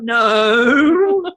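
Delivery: drawn-out, loud, tremulous no; trembling voice. Retold or imitated. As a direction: imitate her with a quivering wail. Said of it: "she gets that tremor in her voice".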